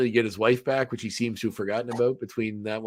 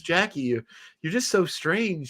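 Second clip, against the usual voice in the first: higher, slower quoted woman; 6 semitones higher, slower than usual. Retold or imitated. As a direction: lightly voice a puzzled bar patron, drawing it out. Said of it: "And this one woman goes".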